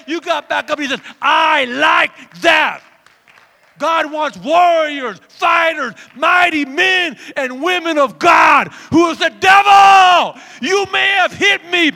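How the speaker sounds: loud, high preacher shout; full-force preaching. Acted. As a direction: roar it out to rally the congregation.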